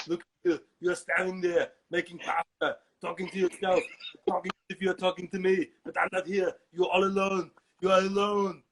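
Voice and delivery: loud Austrian muscleman scolding; berating action-star impression; Arnold Schwarzenegger. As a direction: build to a booming finish, heavy Austrian accent. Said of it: "then Schwarzenegger pops up".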